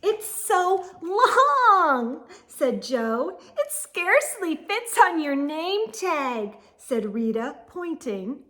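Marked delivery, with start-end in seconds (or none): 0.0-2.2 loud, very slow mocking drawl; teasing boy. A narrator's reading. Joe teasing her name. 3.6-6.6 put-on teasing girl. A narrator's reading. Rita teasing.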